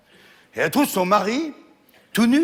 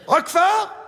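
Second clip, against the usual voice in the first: wife's voice pitched way up, laughing; high squeaky wife; 12 semitones higher, 3 dB louder, slower than usual. Acted. Wife in the dark asking her husband, high and puzzled.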